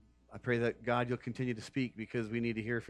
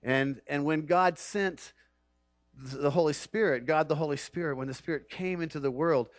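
rising, louder preaching voice; 4 semitones higher, 5 dB louder than usual. Real, not acted. Pastor preaching to his church, with rising force. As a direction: build up the sermon with force.

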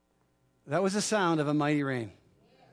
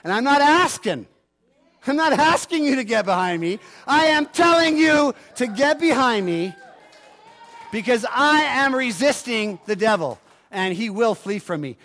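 loud, higher, shouting declaration; preacher shouting that he is telling, not asking; preacher's shout; 4 semitones higher, 10 dB louder than usual. Acted. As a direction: shout it like you mean it.

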